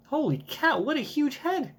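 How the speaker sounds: put-on high excited kid exclaiming; high childlike voice. Bonnie gawking at Vegapunk's huge head, high and childlike. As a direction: gasp like a surprised child.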